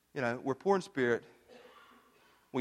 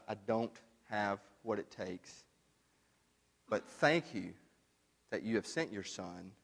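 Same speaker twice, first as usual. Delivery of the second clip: soft, lower, humbled prayer; humble prayer; 5 semitones lower, 8 dB quieter than usual. Acted. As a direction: pray it quietly, bowing before God.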